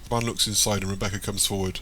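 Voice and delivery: ordinary delivery, nothing marked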